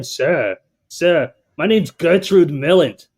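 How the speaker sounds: Gertrude voice. Acted. Trainer plays caller Gertrude for trainees, loud and high. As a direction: ham up a chain-smoking old dame. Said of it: "That's an amazing voice".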